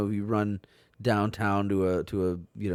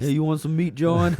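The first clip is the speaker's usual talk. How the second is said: mock store clerk; 8 semitones higher, 5 dB louder than usual. Mock Baltimore store clerk offer.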